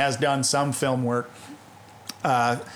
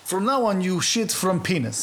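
put-on comic Russian-accented decree; hammed-up Russian accent; mock Russian accent; 4 semitones higher than usual.

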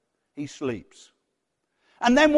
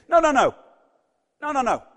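raised, quick-fire refusal; firm rebuke; 3 semitones higher, 4 dB louder than usual. Acted. Jesus rebuking over-eager Peter, firm rebuke. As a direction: cut him off, forceful then easing. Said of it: "Jesus rebukes him and says".

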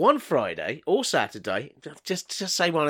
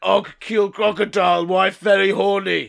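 loud, higher caveman voice; 5 semitones higher, 5 dB louder than usual. Acted. Caveman boasting to the assistant, caveman voice. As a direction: declare it proudly like a caveman.

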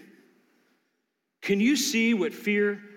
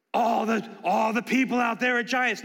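mocking the fearmongers; 3 semitones higher than usual. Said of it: "how the however people do it".

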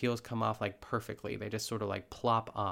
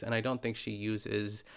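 ordinary talk

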